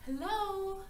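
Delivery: a small, unsure hello to an empty cottage; hesitant knock-and-call; tentative hello. A narrator's reading. Goldilocks calls at the door.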